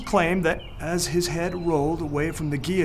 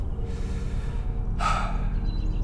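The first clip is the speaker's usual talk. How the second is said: deep thoughtful sigh. Acted. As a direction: let out a slow, pondering sigh. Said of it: "drew in a deep, thoughtful breath".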